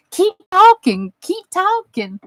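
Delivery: high-pitched, eager plea; delighted, high-voiced urging. Acting out her eager reaction to friend.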